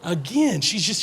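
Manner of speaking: normal talking voice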